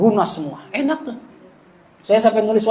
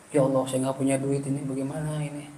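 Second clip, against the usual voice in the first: quiet desperate prayer; 6 semitones lower, 11 dB quieter than usual. Retold or imitated. Praying when broke.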